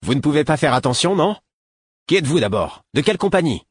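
loud, high, indignant challenge; angry exclaim. Acted. Young guard provoking a stranger, loud indignant shout. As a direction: bark it at the stranger to pick a fight. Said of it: "pour s'exclamer".

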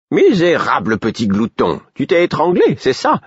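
rising, exasperated scold of a pet. Acted. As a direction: chide the greedy little beast. Imbre scolds the choking weasel.

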